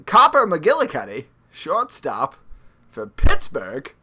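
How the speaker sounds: put-on loud, high old-time radio announcer; old-timey baseball announcer. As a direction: belt it like a vintage ballpark announcer. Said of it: "old-timey baseball voice".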